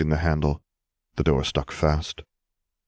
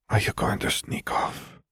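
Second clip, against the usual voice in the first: soft breathy whisper in the dark; a lover's worried whisper at night; 4 dB quieter than usual. A narrator's reading. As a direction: whisper it so as not to wake anyone. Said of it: "Fleur whispered".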